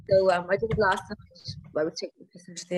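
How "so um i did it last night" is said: ordinary delivery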